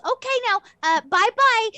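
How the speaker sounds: high, loud, insistent goodbye; shooing farewell. Acted. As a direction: hurry them out the door at high pitch. Old lady shoos visitors out.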